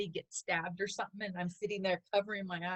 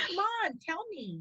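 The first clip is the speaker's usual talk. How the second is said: 1 pleading; 9 semitones higher than usual. Retold or imitated. Pleading with husband during movie.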